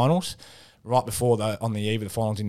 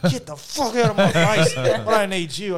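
higher, louder, half-laughed retort; laughing mock yell; 9 semitones higher, 6 dB louder than usual. Retelling his reply to Josh Caddy, a laughing mock yell. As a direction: bark it back through a laugh, hammed up.

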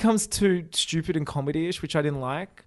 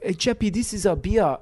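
mock gang voice; 6 semitones higher than usual. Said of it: "they're like".

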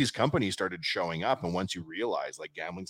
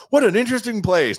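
high, louder fake-polite compliment; mock polite visitor; 12 semitones higher, 6 dB louder than usual. Imitating the Swedish CEO's polite compliment on the office. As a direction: gush politely about a shabby office. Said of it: "he was like".

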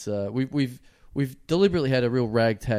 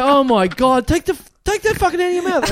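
high, loud, rushed alarm; retold panicked parent outburst; 11 semitones higher, 5 dB louder than usual. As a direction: blurt it out in horrified disbelief, half laughing.

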